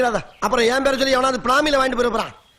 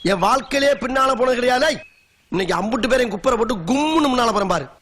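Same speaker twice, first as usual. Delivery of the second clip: ordinary talk, nothing marked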